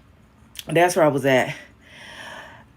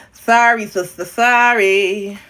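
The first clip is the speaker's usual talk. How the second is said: exaggerated mock apology; 5 dB louder, much slower than usual. Repeats mock apology, bigger.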